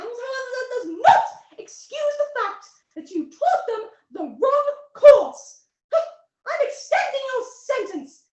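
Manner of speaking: high, loud, imperious verdict; alien judge's ruling. Acted. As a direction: hand down the punishment to the whole court. Alien judge extends the sentence.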